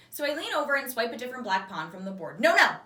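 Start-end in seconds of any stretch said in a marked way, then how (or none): 2.4-2.8 sharp, louder, higher cry of no; alarmed little shout; urgent no. Acted. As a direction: stop her quickly, worried. Bob worried stopping her.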